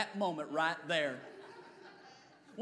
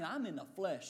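ordinary talk

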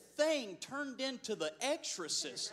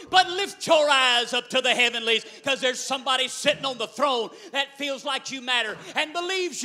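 louder, rising full-force preaching; 2 semitones higher, 13 dB louder than usual. Real, not acted. Preacher urging the congregation they matter, full force. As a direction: build the congregation up to a shout. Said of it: "I feel like preaching right now".

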